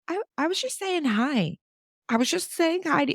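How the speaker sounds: very high, timid little voice; sweet stranger. Retold or imitated. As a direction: squeak it out like a harmless stranger. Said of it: "they were like".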